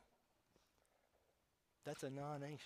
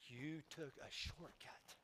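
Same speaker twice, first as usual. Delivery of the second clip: slow, choked, fading to a whisper; real tears, trailing into a whisper; tearful; 7 dB quieter, slower than usual. Real, not acted. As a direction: hold back the tears. Preacher overcome with emotion.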